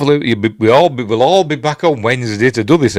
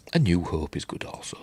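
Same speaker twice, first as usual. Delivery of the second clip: whispering; 3 semitones lower, 10 dB quieter than usual. Real, not acted. Reading a chat comment.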